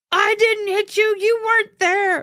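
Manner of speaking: imitating the driver yelling back, high and loud; mock yell. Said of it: "One guy got out and yelled at me".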